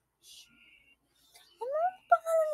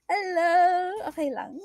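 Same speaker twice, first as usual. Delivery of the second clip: ordinary talk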